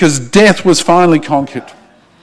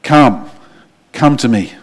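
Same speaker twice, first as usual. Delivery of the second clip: tender call of the Lord; 8 semitones lower, 3 dB quieter than usual. Acted. Preacher voicing the Lord inviting listeners, softly tender. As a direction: lower and softer, gently inviting. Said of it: "the Lord is reaching out to you today and saying".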